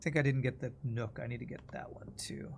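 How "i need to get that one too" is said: ordinary talk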